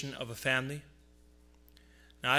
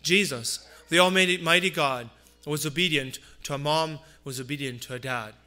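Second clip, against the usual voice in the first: louder, rising pulpit voice; fervent preaching; 3 semitones higher, 5 dB louder than usual. Acted. Pastor preaching to his congregation about Jesus' family, fervent. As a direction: drive the point home from the pulpit.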